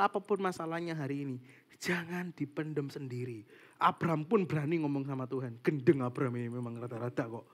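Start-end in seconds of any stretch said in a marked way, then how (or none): none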